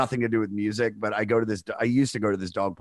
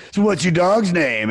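eager; 7 semitones higher, 5 dB louder than usual. Retold or imitated. Eager attempt to chum up.

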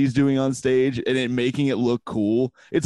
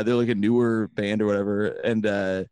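normal talking voice

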